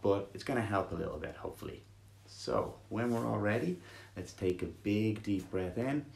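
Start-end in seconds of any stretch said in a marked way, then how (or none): 2.3-6.0 soothing guided meditation read. Acted. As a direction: ease them gently into stillness.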